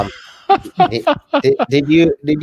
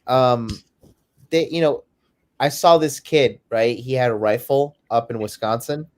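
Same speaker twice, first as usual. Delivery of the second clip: normal talking voice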